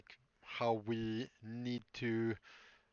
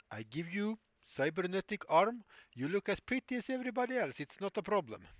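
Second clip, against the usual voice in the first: put-on creepy doctor in a higher Russian-accented voice; weird Russian accent; 7 semitones higher than usual. Creepy doctor offers a patient cybernetic fixes, weird Russian accent. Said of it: "he has a weird Russian accent".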